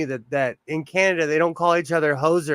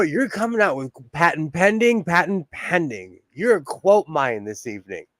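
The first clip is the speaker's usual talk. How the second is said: usual speaking voice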